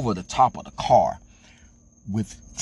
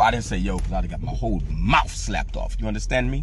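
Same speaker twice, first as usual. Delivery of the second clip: ordinary talk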